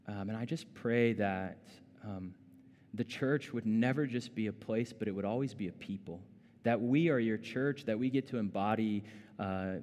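soft, hushed pastoral prayer voice. Acted. Pastor praying over the congregation, hushed and humble. Opening prayer before the passage.

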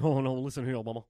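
low [name] croon; a hint of [name], low and easy; lightly done [name] impression. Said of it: "he's like".

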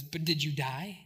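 low, quiet deadpan question; Paul. Retold or imitated. Paul shrugs off the danger to survivors, low and dry. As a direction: imitate understated comfort, dropped right down. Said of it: "Paul says".

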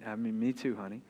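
very soft, low fatherly admission; hushed, aching comfort; tender, sad commiseration. Retold or imitated. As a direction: quietly share a child's heartache. Father sharing daughter's sadness, retold.

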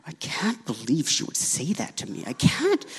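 hissed private rant under the breath; fuming whisper. Retold or imitated. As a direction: whisper the grudge so she can't hear. Angry whispered thoughts while journaling.

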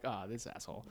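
low, soft grumbled thought; quiet inner-voice aside; thought to oneself. Retold or imitated. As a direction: say it under your breath, as a private judgment. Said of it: "In my mind".

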